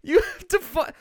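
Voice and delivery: high, loud disbelief; exasperated. Real, not acted. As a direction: shout it incredulously. Continuing exasperated outburst.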